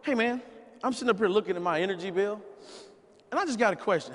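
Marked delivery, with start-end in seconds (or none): none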